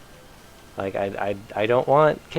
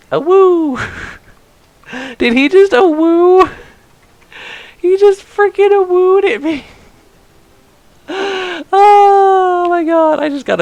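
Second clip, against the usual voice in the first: very high, loud disbelieving squeal; stunned squeal; 6 dB louder than usual. Real, not acted. Streamer reacting to a game character's awoo, stunned squeal. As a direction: squeal it out in amused shock.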